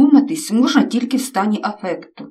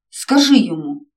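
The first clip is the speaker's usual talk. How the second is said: nervous exclamation; 5 dB louder than usual. A narrator's reading. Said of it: "нервово вигукнув мамі".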